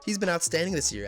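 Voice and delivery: normal talking voice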